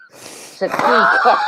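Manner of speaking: slow, high laughing words. Real, not acted. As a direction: laugh through the punchline. Laughing at own blunder.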